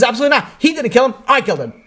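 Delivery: high-pitched emphatic protest; acted-out heated refusal; insistent denial. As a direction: insist on taking the blame. Condemned man argues against friend.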